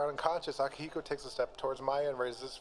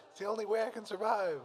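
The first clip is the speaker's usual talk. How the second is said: raised-pitch yell without much volume; mild mock yell; 8 semitones higher than usual. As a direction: plead it in a high, half-hearted yell. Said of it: "Aigis yells".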